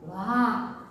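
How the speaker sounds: usual speaking voice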